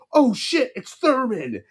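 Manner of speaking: high, sudden "oh shit" of surprise; startled exclamation. Retold or imitated. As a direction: blurt it out like you just spotted a celebrity. Spotting star player in traffic.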